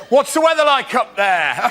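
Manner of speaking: lightly voiced pub joker; jeering local. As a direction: imitate a local heckling a tall man. Local shouts joke at tall man.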